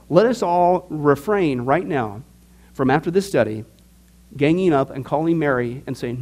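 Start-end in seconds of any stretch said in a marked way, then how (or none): none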